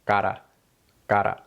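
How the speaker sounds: cara with one quick tap; tapped r. Shown as a demonstration. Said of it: "That ara sound is what we want".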